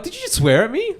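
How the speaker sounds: very high, loud imitation of an affronted lady; mock her shocked disbelief, rising sharply at the end; outraged accusation. Quoting the offended lady.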